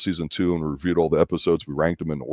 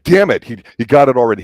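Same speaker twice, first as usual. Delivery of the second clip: loud, high, exasperated burst; frustrated outburst; 5 semitones higher, 8 dB louder than usual. Retold or imitated. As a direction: blurt it like you've been outsmarted. Viewer reacting to clever detective.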